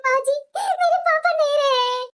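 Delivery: crying, slow and broken by sobs; grief-stricken weeping, drawn out; tearful. Acted. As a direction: sob out terrible news. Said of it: "तुम इस तरह से रोने गाने लगेगी".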